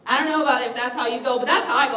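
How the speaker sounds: ordinary talk, nothing marked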